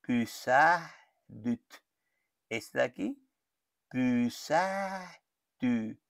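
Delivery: each form of "pusadut" said very slowly; slow conjugation drill. Shown as a demonstration. Teacher reads the verb forms slowly for copying. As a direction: model each Finnish form slowly for the student. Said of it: "Cómo sería este entonces".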